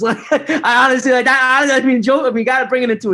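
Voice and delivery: laughing it off, high and loud. Real, not acted. Laughing while telling his brother about the diagnosis. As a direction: talk through a real laugh.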